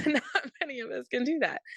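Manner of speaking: real chuckle running into the words; laughing. Real, not acted. Host to interviewer about spraying chemicals without breathing, laughing. Laughs at her rhetorical question.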